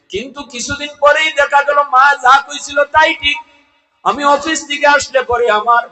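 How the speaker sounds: high, loud storytelling sermon; impassioned preacher's chant, easing at the end; full-force preaching. Acted. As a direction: declaim the turn in the tale at high pitch.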